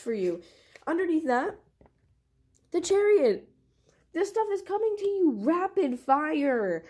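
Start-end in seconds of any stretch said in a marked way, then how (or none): 0.9-3.5 high and loud, bursting with excitement; thrilled outburst about the cards; overexcited. Real, not acted. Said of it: "going off of the charts right now". 4.1-6.8 excited. Real, not acted. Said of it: "going off of the charts right now".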